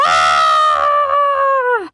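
loud low shriek of fright; scream. A narrator's reading. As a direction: yelp at the sparks. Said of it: "screamed Sniz".

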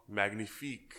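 mock French chef accent; lightly put-on French, savoring it. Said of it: "Chef's kiss?".

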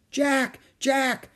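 high, repeated call; crying out. A narrator's reading. Annie answers Jack from the forest, crying out. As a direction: call the name from afar. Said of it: "cried Annie".

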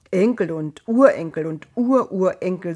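mock the promise word by word; sarcastic echo of the voice's promise; bitter mockery. Abraham mocks the promise.

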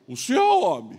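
loud mock drunken challenge; drunk man. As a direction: imitate an insistent drunk, punching it out. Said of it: "Aí chegou o bêbado". (imitação de bêbado falando alto)

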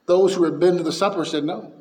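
usual speaking voice

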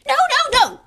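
high, loud squeak. A narrator's reading. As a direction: shriek it out in panic. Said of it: "squeaked Dobby".

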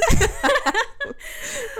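a burst of real, high laughter; bright laugh. Real, not acted. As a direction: laugh out loud at yourself. Both laughing at their ignorance.

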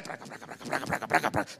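voiceless rattling praca sounds; whispered clatter of cups. Retold or imitated. Prisoners banging cups, mimicked softly. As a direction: rap out tin cups on bars.

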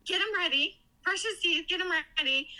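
high, eager instructions; excited nurse. Retold or imitated. Nurse plans to show off the talking boy, excited. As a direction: imitate a nurse giddy with news. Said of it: "super excited. She's, like, crying, laughing".

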